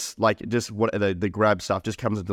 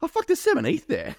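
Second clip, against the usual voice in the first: much higher, incredulous exclamation; astonished. Retold or imitated. Reading the ledger, high astonished exclamation. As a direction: sound floored by the find. Retelling finding coins on ledger.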